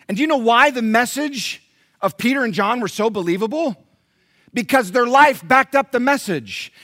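high, driving preacher's cadence; full-force preaching. Acted. Pastor tells church their life must back the message, forceful preaching. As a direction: press the questions home to the congregation.

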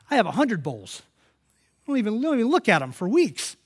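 high, loud, incredulous outburst; exclaimed confession. Retold or imitated. Stunned by her gift of a bowl, loud incredulous confession.

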